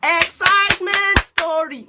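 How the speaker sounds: loud, high, drawn-out burst of excitement; exuberant. Shown as a demonstration. Wife contrasting herself with her calm husband, exuberant and loud. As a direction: belt it out slowly, bursting with excitement. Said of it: "Me, on the other hand".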